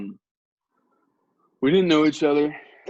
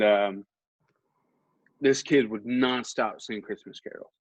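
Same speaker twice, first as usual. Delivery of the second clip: usual speaking voice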